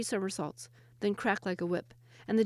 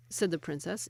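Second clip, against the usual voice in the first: usual speaking voice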